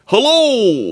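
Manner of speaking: very high, drawn-out silly hullo; hammed-up goofy dub of a greeting; goofy American. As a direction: whoop it high, then let it drop. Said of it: "I'll remake the scene and I'll dub in".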